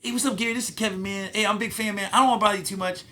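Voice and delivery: put-on high, eager, polite young comic voice; imitating Kevin Hart. Young comic on the phone, eager and high. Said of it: "he was just like he is now".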